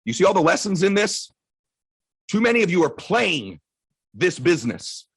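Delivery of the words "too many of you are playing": ordinary talk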